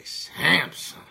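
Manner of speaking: Samson said high and loud, hamming the misreading; Samson punched. Shown as a demonstration. Proudly repeating the misread name to chat, loud and high. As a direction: belt out Samson with a p in it. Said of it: "we gonna say it like that anyway".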